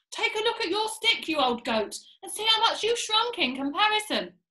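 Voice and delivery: high-pitched, insistent nagging; taunting rebuke. Acted. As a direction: berate the old goat, pitched high. Mr Twit berating his wife.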